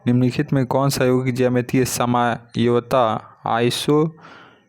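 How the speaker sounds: usual speaking voice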